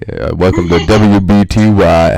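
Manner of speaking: slow, loud radio DJ intro. Pretend. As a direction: announce it like a radio host. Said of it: "put me back into radio voice".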